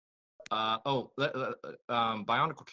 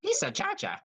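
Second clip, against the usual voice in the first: Jar Jar voice. Acted. Mimicking Jar Jar's greeting.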